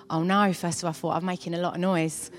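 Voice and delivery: low, softened aside; retold inner thought. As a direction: voice a private thought, dropping low and quiet. Her own worried thought, retold.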